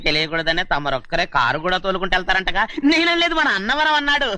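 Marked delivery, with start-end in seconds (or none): 2.9-4.3 hasty, high-voiced passing of blame; high-pitched denial. Servant disowning gossip to his master, high-pitched denial. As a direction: jump up high to deny it.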